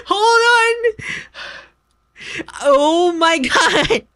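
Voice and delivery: high, breathless laughing; can barely speak through laughter. Real, not acted. Laughing fit.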